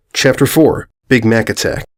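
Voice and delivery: ordinary delivery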